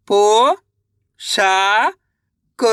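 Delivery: अ, नो, खा drawn out separately, the first two rising; syllable by syllable. Shown as a demonstration. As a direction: sound out अ, नो, खा with long gaps. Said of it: "एक अक्षर का उच्चारण सही से". (अ, नो, खा अलग-अलग खींचकर)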